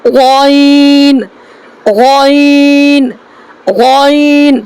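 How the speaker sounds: غین stretched very slowly, throat sound; slow model of غین; drawn-out letter demo. Shown as a demonstration. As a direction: hold غین long for the class. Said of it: "تو ہم کو یہاں نکالنا ہے کیا".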